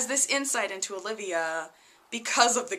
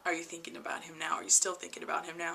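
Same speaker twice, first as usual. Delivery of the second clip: sexy voice; 5 semitones lower, 11 dB quieter, faster than usual. Retold or imitated. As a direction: breathe it close and seductive. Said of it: "in the kinda, like, sexy voice".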